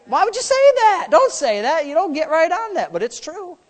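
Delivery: ordinary talk, nothing marked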